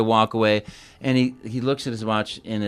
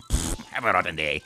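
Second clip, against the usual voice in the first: low, sneering Wario; 8 semitones lower, 5 dB quieter than usual. Acted. Wario grumbling at the host, sneering.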